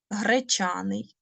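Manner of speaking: Гречаний whispered slowly, no voice. Shown as a demonstration. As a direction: breathe the word Гречаний like a secret to the class. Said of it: "teacher repeats word".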